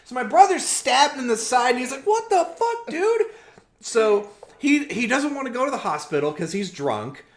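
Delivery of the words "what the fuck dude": very high, incredulous protest; mock outraged disbelief. Stabbed brother protests to attacker, high mock outrage. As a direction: squeak it out in mock shock.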